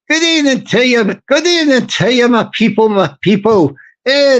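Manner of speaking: mock Irish politician's stump speech; rally the people in a put-on Irish brogue; emphatic, hammy Irish party leader; Irish accent. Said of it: "tone it down for the Irish".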